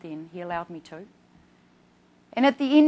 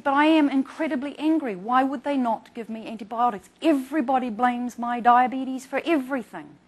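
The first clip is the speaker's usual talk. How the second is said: mock angry, louder and higher; a touch of his fury, retold; patient complaining angrily about the hospital; retold anger; 4 semitones higher, 9 dB louder than usual. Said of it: "he said".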